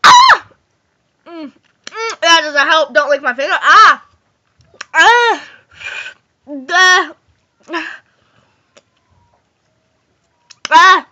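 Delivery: loud gasping through a burning mouth; mouth on fire. Real, not acted. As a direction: pant and groan from the spice. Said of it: "Oh, that's spicy".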